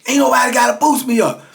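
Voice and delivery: high, insistent angry retort; retold outraged comeback; imitated indignation. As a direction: insist hotly, voice pitched right up. Customer answers back angrily.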